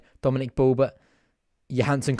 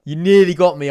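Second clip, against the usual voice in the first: loud and higher, half-laughing; loud laughing protest; 5 semitones higher, 11 dB louder than usual. Real, not acted. Streamer telling the prankster he nearly fell for it, loud. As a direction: call it out to the chat. Caught by prank super chat.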